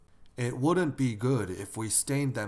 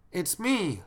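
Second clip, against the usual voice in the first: put-on high-pitched child's reply; child at the door; 8 semitones higher than usual. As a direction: call out like a kid at the door.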